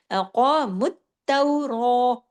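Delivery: क़ामुत तौरा slowly recited, with क़ा and रा both heavy and full-mouthed; heavy qaf and ra. Shown as a demonstration. Teacher demonstrating heavy letters, slow full-mouthed recitation. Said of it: "हमने क़ाफ़ को मोटा किया और रा को मोटा किया".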